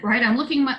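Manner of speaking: ordinary talk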